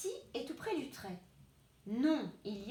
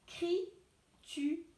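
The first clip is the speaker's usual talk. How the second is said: Écriture said slowly as é-cri-ture; slow teacher reading of Écriture; slow syllables; 3 semitones higher, much slower than usual. Shown as a demonstration. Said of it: "couper le mot".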